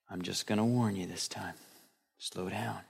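low, soft, easygoing officer voice; lenient cop. Retold or imitated. Preacher imitating a cop letting a speeder off, low and soft. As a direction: let them off gently, low and soft. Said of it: "They say".

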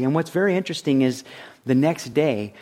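normal talking voice

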